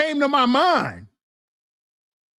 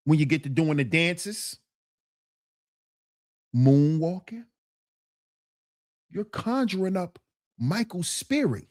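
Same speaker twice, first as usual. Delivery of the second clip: ordinary delivery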